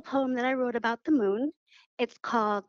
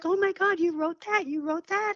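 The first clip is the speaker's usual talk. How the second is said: amazed students; 8 semitones higher than usual. Retold or imitated.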